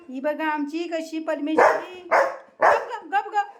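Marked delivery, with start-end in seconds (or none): 2.1-3.5 quick, high-pitched shushing; sharp hushing. Real, not acted. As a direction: hush the pet in short, raised bursts. Hushing the noisy pet.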